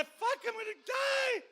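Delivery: high, loud, slow desperate yell; panicked shout. Retold or imitated. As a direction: cry out sure of death. Sees ship coming at them.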